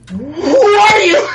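high, loud, slow cry; shocked shout. Retold or imitated. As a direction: yell in betrayed disbelief. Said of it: "And then suddenly".

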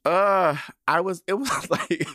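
high, loud wail breaking into laughter; overwhelmed, wail and laugh through it; tearful laugh, pitched high; emotional breakdown. Real, not acted. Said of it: "I broke down".

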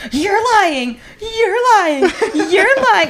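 high, rising disbelief; in shocked denial; disbelieving. Retold or imitated. Ollie repeats in disbelief.

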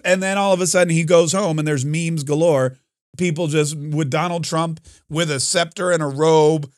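ordinary delivery

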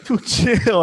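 high, loud "dude, chill"; telling offended coworker to calm down; brushing it off. Retold or imitated. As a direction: wave off his overreaction. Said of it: "I'm like".

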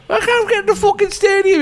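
high whiny moaning impression; moaning footballer. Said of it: "Christ, he was moaning".

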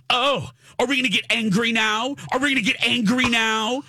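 high-pitched goading questions, rising at the end; mocking taunt. Mimics confrontational mother's taunt.

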